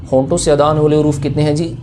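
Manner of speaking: ordinary delivery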